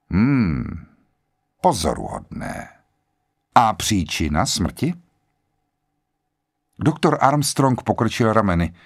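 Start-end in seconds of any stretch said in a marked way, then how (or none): 0.1-4.9 quiet, precise voice. A narrator's reading. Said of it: "svým tichým, ale naprosto zřetelným hlasem".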